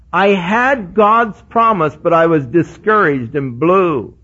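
loud, rising pulpit preaching. Acted. Pastor exhorting his congregation, loud full-force preaching. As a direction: drive the point home to the church.

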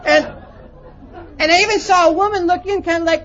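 usual speaking voice

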